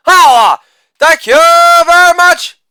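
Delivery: loud "Holla", then a very high, loud "thank you very much"; hammed-up falsetto victory call; catchphrase shout. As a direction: belt out the catchphrase like a hype man.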